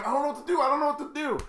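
high, helpless mock-panic voice; flustered young girl. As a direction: play the lost young driver. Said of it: "this young girl going".

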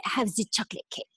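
imitated Frenchman ordering dessert; quick line in a put-on French accent; thick French accent. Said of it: "this thick French accent".

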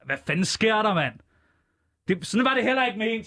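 high, hammed-up pleading; mock exasperated call. Host calling to the cohost offstage, mock exasperated. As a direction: call out that you didn't mean it.

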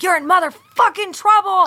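high, loud threat; retold shout. Mother scolding her daughter at the car door, high loud threat. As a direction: bark it as an angry mom. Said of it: "I was like".

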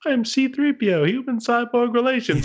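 high, prim robot butler voice; C-3PO impression. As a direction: chirp it like a fussy droid. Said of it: "all of a sudden it's like".

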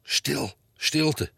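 a boy's put-on bossy hushing; mock commanding hush. A narrator's reading. Cheeky boy hushing the classroom like a teacher. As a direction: play the strict one, hushing the class. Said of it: "commandeerde Bram Hezing".

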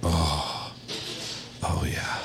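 very low, hushed pleasured whisper; mock aroused whisper. Caller enjoying pizza talk, hushed mock-aroused whisper. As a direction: breathe it out as a sultry whisper.

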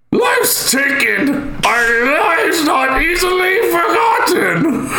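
high-pitched strongman voice, words drawn out slowly; Koth. Acted. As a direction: wail it high and slow like a weird witch. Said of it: "the high-voiced strong man".

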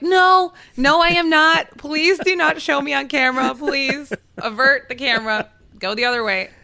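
high, loud frantic refusal fading toward the end; mock-panicked, high and loud, then calming; panicked plea. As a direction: beg the camera crew to look away, in a high panic. Begging ESPN camera not to film.

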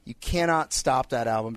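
ordinary talk

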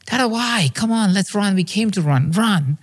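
put-on high-pitched, pleading toddler voice; imitated two-year-old son. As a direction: whine at dad to keep running. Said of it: "He says".

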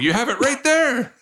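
high-pitched mock outrage; indignant insistence. Father pointing at the bakery menu board, high indignant insistence. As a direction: protest with the pitch shooting up.

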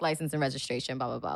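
mumbled cop routine. Retold or imitated.

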